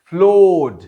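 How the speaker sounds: फ्लोड said loudly as floɖ, no R; non-rhotic floored. Shown as a demonstration. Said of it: "आर की pronunciation नहीं पाते".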